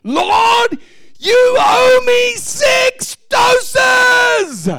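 high, loud, drawn-out crying shout; joyful shout. Retold or imitated. Preacher on the floor shouting to God, high crying shout. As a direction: shout it out slowly, half sobbing with joy. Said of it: "I shouted".

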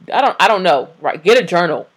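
normal talking voice

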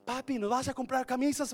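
put-on high, loud pleading kid voice; begging child. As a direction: wheedle papa for money. Hijo pide camisas. (niño suplicando agudo al papá)

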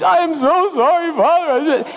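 put-on high, tearful little-boy apology; imitating crying boy. Tearful boy apologizing to the priest, high and weepy. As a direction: lightly imitate a sobbing kid saying sorry. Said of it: "he was crying".

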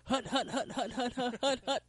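high, loud "hut hut" calls; quarterback calling cadence. Pretend. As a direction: bark the snap count like a quarterback. Football sign-off cadence.

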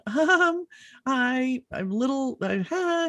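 high, halting retold reluctance; squeaky-high, faltering attempt at an answer; nervous hesitation. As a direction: stall in a small high voice, unsure. Retold nervous answer to ski patrol.

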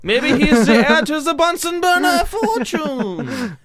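put-on high loud German accent; pompous German accent. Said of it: "keep doing the German accent".

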